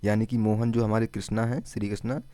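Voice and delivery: ordinary delivery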